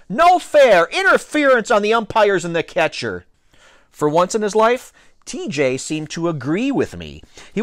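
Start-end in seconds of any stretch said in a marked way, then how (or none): 0.1-3.2 high, loud cry of foul; yelled protest. A narrator's reading. Boy protesting the laughing umpires and catcher, yelling. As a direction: yell the complaint with indignation. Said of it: "I yelled".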